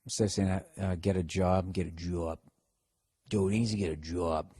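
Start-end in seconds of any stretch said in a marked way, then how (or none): 0.9-2.4 low Boston-accent "job"; soft, low Boston drawn vowel. Shown as a demonstration. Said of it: "the Boston accent kinda came back". 3.3-4.5 low, softer Boston accent; Boston vowel on "job". Shown as a demonstration. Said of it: "working on your Boston accents".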